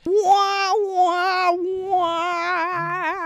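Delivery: high sung wah-wah of failure; mock sad trombone. Mocking the cat reveal with a sad trombone wah-wah. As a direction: sing a sad trombone letdown. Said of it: "sad trombone sound effect".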